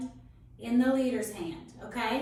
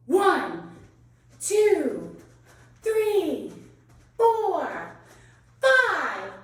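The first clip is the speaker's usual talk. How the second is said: loud countdown; counting down aloud; 4 semitones higher, 6 dB louder, much slower than usual. Real, not acted. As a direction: call each number out firmly. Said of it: "You have five seconds".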